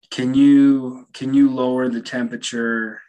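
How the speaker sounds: imitated friend calling to an unseen presence.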